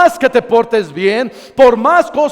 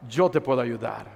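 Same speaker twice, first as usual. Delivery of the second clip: low, soft, steady reassurance; calm voice of Jesus; 13 semitones lower, 13 dB quieter than usual. Acted. Jesus answering the pleading sinner: low soft reassurance. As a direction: answer gently from above, unhurried.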